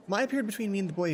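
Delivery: usual speaking voice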